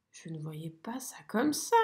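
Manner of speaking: chuchotement déçu, bas et doux. A narrator's reading. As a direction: murmurer sa déception. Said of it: "dit Pipioli déçu". (souris déçue qui chuchote)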